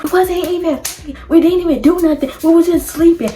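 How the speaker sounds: high, loud protest of innocence; mock indignant denial. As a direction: replay insisting you did nothing. Retold lying denial to mother.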